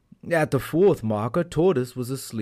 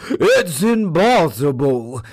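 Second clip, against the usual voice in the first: loud, slow, higher scream; 4 semitones higher, 8 dB louder, slower than usual. A narrator's reading. Beaten tiger screams at the finish line. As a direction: scream it in disbelief. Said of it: "he screamed".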